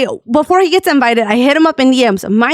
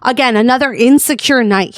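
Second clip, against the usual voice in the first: normal talking voice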